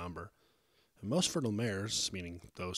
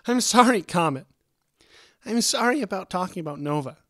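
high, loud, upset outburst; anguished, raised-voice plea; distraught apology; 11 semitones higher, 13 dB louder than usual. A narrator's reading. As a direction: cry out the apology, close to sobbing. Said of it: "Angel's head dropped into a couple small sobs".

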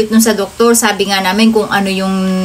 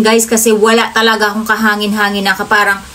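usual speaking voice

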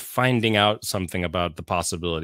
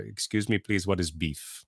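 put-on Indian accent; 2 semitones lower, 7 dB quieter than usual.

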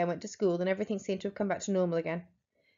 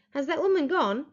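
higher fearful shout down; anxious shout; 7 semitones higher than usual. Acted. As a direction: call down, scared and urgent. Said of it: "I shouted".

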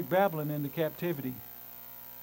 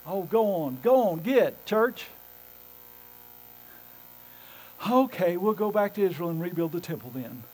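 grudging Israel; 3 dB louder than usual. Retold or imitated. Israel giving in about going home, hammed-up grumble. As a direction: sigh and give in reluctantly. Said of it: "And they're going".

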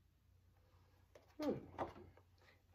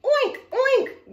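high, loud squeal-like oinks; pig oink; 23 dB louder than usual. A narrator's reading. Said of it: "grunted the pig".

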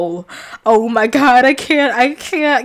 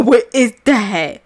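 usual speaking voice

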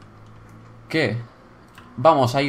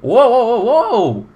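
amazed excitement; 7 semitones higher than usual. Real, not acted. Player amazed at the view, high excited wonder. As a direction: marvel aloud in a raised voice.

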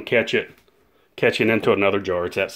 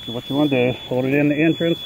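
through a respirator; 4 semitones higher, 5 dB quieter than usual. Real, not acted. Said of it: "through this respirator".

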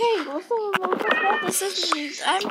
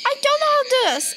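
high, loud complaint, rushing at the end; frustrated outburst; 6 semitones higher, 5 dB louder, faster than usual. Real, not acted. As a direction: blurt it out, fed up with the task. Frustrated at failed crafting.

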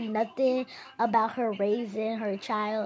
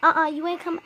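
raised, higher retold scolding; mock scolding mom; 6 semitones higher, 6 dB louder, faster than usual. Kid's mom scolding him on the phone, raised voice. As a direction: snap it back like an annoyed mom.

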